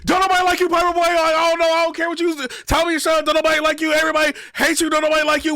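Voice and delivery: high, loud mocking voice reading out taunts; mocking Briz.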